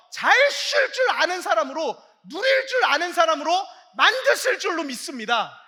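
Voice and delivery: loud, high proclamation building up; full-force preaching. Real, not acted. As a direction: proclaim it with everything you have. Preacher proclaims blessing loudly. (온 힘을 다해 선포하듯 외치며)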